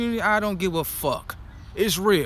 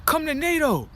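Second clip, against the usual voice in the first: mock recruiter; 6 semitones higher than usual. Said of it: "They gonna be like".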